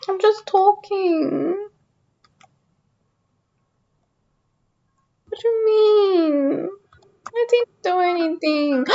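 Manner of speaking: soft, slow, drawn-out protest; pouty mock whine. Streamer accused of everything again, pouting innocently. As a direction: whimper innocently like a wronged child.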